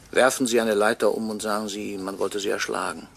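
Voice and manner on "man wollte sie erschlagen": usual speaking voice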